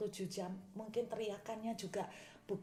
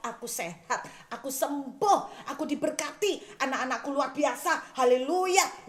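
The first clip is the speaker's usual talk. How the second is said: higher, louder joyful confession; joyful declaration; 6 semitones higher, 8 dB louder than usual. Shown as a demonstration. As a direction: declare the blessings with rising joy. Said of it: "Teruslah perkatakan".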